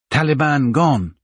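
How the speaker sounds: put-on a foreign boy reassuring in simple words; foreign child's broken English. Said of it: "timid, foreign".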